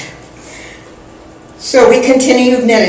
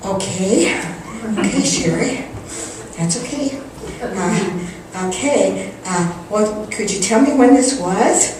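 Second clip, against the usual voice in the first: much softer, careful reply; poised, soothing; 7 dB quieter than usual. Retold or imitated. Answering her accusing friend, quiet poise. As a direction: gently placate an upset friend, hesitantly. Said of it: "I had great poise".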